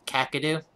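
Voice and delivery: Kakadu with "a" as in "cat" first; trying a pronunciation. Shown as a demonstration. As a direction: say Kakadu as "KACK-a-doo", questioning. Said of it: "Is that the first one I said?".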